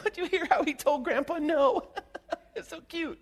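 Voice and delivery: high, cooing parent, laughing; doting parent. Retold or imitated.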